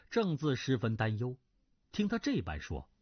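usual speaking voice

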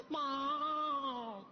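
long drawn-out cry for mother; hammed-up helpless wail; mock despairing wail. Wife wails in mock despair.

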